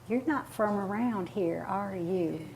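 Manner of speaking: soft, lightly put-on Southern accent; a sly good old boy's Southern twang, said softly; Southern drawl. Memphis good old boy sizing up a Californian, mild Southern accent. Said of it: "prejudice against a Southern accent".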